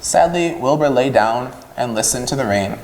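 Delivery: ordinary delivery, nothing marked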